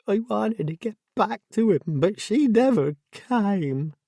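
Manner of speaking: keen it out, lost and grieving; tearful wail. A narrator's reading. Jessie grieving to Debbie over the missed pickup, tearful wail.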